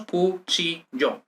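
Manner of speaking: "cuchillo" broken into cu, chi, llo; syllable by syllable. Shown as a demonstration. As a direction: count out "cuchillo" in three syllables. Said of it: "tres sílabas".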